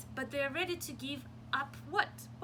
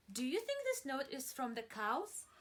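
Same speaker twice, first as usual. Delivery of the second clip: plain reading voice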